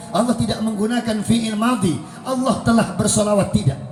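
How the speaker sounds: ordinary talk, nothing marked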